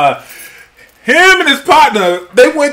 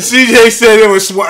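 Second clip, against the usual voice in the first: normal talking voice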